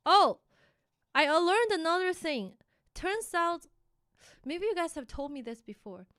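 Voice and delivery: normal talking voice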